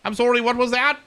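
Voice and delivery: high, loud, huffy commander voice; indignant admiral. Acted. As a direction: play a pompous admiral scolding his crew. Admiral character in game.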